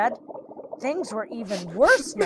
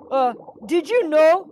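higher, hesitant little fish voice; nervous stalling; 6 semitones higher, 3 dB louder than usual. Acted. Birdie stalls the hungry shark, higher nervous voice. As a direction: start hesitantly, pitched up.

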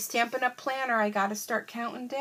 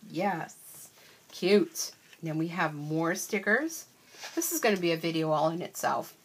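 ordinary talk, nothing marked

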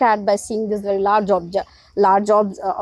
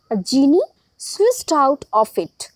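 ordinary delivery

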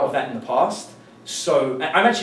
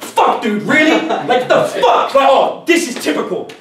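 high, loud mock fury; higher and louder fake outrage with a laugh; acted aggression; 10 semitones higher, 7 dB louder, slower than usual. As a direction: blow up at him out of nowhere. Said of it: "you instantly get aggressive".

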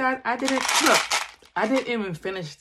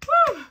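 relieved high whew; 6 dB louder than usual. Real, not acted. Recovering from sourness.

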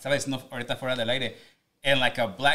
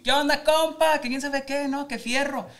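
much higher, hyped regional Mexican radio host voice; 12 semitones higher than usual. Retold or imitated. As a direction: ham up a Mexican DJ greeting the compas. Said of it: "So it was Mexican as fuck".

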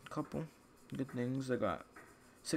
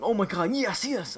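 mock excited yell; 7 semitones higher than usual. Gamer imagining getting the rare camo, mock excited yell. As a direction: act out a small burst of yelling. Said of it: "I literally probably start yelling".

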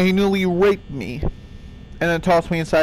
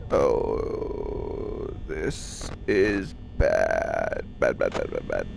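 much lower, soft, slow muttering of doom; hushed dread; 7 dB quieter, much slower than usual. Real, not acted. Player muttering as Freddy creeps closer in the dark. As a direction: breathe it out in low dread.

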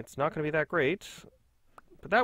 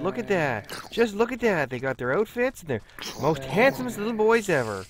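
cooing, a little raised and loud; 4 semitones higher, 4 dB louder than usual. Real, not acted. Player admiring the handsome little twins, doting coo. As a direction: gush fondly over the cute outfits. Still doting on the dressed-up creatures.

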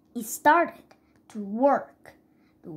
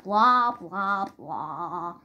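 dismissive blah; 6 semitones lower, much slower than usual. Acted. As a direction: brush it off, fading away. Dad brushing off the story.

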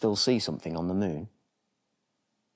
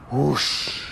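loud, higher blown whoosh of wind; 8 semitones higher, 6 dB louder than usual. Acted. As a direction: blow the word out like a gust. Gust of wind in story.

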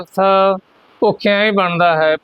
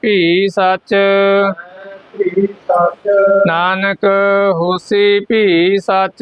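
slow, drawn-out phrase-by-phrase recitation; scripture recitation; much slower than usual. Shown as a demonstration. Teacher leading learners through the scripture, slow measured recitation. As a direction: stretch each word ending, leave room for repetition.

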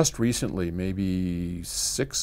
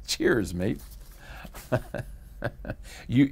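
ordinary talk

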